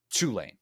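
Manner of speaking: Tulane with TU stressed: TOO-lane; stress on the first syllable. Shown as a demonstration. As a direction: Tulane punched on the first syllable, Cajun style. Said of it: "put all the emphasis on the first syllable".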